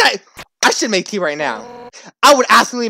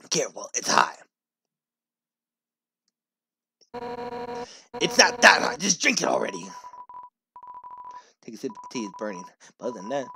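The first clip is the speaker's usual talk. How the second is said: softer, lower read of the fish warrior; a gruff warrior offering tea, held low; Undyne voice; 3 semitones lower, 9 dB quieter than usual. Acted. Streamer voicing Undyne serving tea, quietly.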